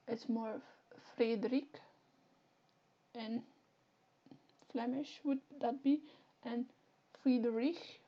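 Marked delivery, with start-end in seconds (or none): none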